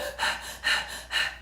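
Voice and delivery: shallow stressed exhale; a quick unvoiced stress sigh. Shown as a demonstration. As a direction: sigh out like someone under stress. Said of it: "and it's like that".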